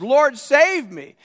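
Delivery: high, loud, slow plea; acted cry for help. Preacher as sinking Peter crying to Jesus, acted plea. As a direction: call out to be rescued, dramatized.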